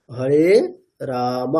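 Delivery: Hare রাম with long aa and final short a, "raama"; correct Sanskrit pronunciation. Shown as a demonstration. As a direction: draw out রাম and end on open a. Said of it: "উচ্চারণটা কেমন করতে হবে". (সংস্কৃত ঢঙে রাম-এর শেষে স্পষ্ট অ)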